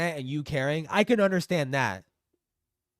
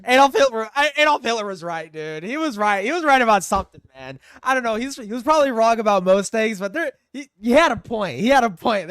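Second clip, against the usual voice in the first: loud; 4 semitones higher, 8 dB louder than usual. Real, not acted. Loud ranting outburst.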